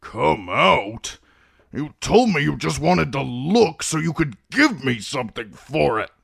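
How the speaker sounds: put-on high, outraged old man's protest; indignant. A narrator's reading. Patient indignant at tooth pulling.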